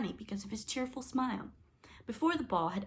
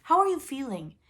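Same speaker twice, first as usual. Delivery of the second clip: higher, louder question; loud polite question; 8 semitones higher, 10 dB louder than usual. A narrator's reading. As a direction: call up to the dragon. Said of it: "she said".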